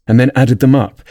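ordinary delivery, nothing marked

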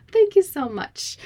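high, bright burst of thanks; gushing thank you. Real, not acted. Effusive thanks to listener.

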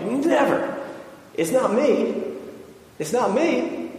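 high, insistent mock protest; Peter. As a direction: imitate an overeager disciple denying it. Acting Peter protesting he won't betray.

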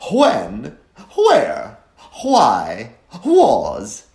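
breathy "hw" at the start of "When", "where", "why", "was"; mock-posh "hwen, hware, hwy, hwas", loud; H before the W. Shown as a demonstration. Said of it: "always pronounce the H before the W".

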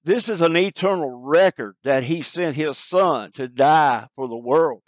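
usual speaking voice

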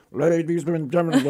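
loud, garbled conductor imitation; mumbled train announcement. College president mocking subway conductors' announcements, mumbled. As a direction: run the words into comic announcement mush. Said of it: "they just talk like they mumble".

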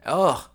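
loud, high groan of disgust; disgusted ugh. Real, not acted. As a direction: groan at a terrible smell. Disgust at the stench.

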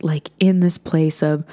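ordinary delivery

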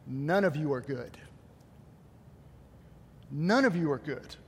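a low, heavy voice of God passing judgment. Pretend. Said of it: "He goes".

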